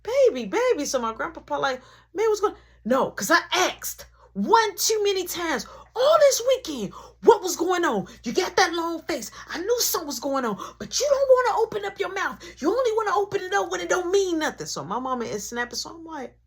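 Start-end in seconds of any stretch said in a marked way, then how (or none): 0.0-0.8 high, louder soothing nana voice; calm the mother down as grandma; high-pitched nana soothing. Retold or imitated. 2.1-2.6 grandpa asking. Retold or imitated. Grandpa asking what's wrong. 2.8-14.7 high, fast scolding tirade; high, fast snapping mom. Retold or imitated.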